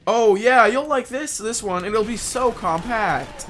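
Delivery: high, loud mocking voice; imitating her. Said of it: "that's what she said".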